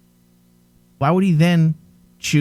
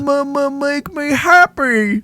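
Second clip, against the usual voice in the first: mocking childlike character; 11 semitones higher than usual. Host mocking how the character talks, childish stammer. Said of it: "Why does he sound like that?".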